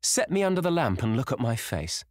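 high, eager challenge; triumphant. A narrator's reading. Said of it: "with a touch of triumph in his voice".

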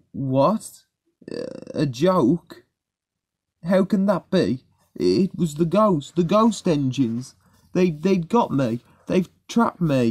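high, faltering, frightened protest; shaken disbelief. A narrator's reading. Rheneas hears his ghost scare was a joke, shaken disbelief. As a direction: stammer it, bewildered and pitched high.

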